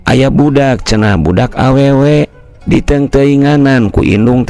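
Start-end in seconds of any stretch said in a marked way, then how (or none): none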